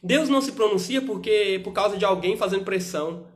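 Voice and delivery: fiery pulpit exhortation swelling up; preaching. Acted. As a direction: preach it harder and harder. Preaching point.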